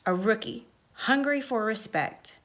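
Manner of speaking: ordinary delivery, nothing marked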